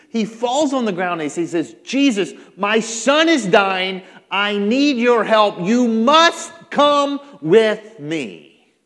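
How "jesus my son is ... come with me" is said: loud, slow, raised-pitch begging; a father crying out for help; desperate plea. Acted. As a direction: plead at full voice, stretching every word. Desperate father begs Jesus for help.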